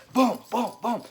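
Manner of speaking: loud, high booms; mock firing noises; shot sounds. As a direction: punch out each shot. Imitating rapid arrow shots.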